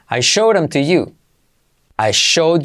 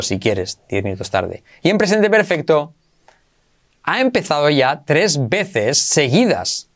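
ordinary talk

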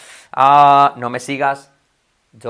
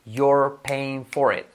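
very slow, every sound of "you're paying for it" spelled out; slow drill; much slower than usual. Shown as a demonstration. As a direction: drag each word for the student to copy. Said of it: "Hacerla I como una E y reforzar la R". (despacio, sílaba a sílaba)

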